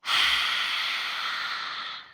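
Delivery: long, breathy exhale; deep sigh. Shown as a demonstration. Guide showing listeners how to exhale, deep audible sigh. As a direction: let a deep breath all the way out. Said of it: "exhale it out with a deep sigh".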